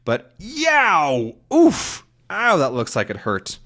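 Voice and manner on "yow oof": high-pitched yow, then oof; mock yelp of pain. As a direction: yelp as if caught in a trap. Tintin steps in a trap.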